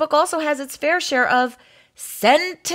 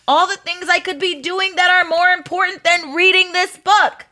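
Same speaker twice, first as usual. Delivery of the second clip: high, fast, rising complaint; exasperated rant; 6 semitones higher than usual. Acted. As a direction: rush through it in mounting frustration. Reluctant reader rants.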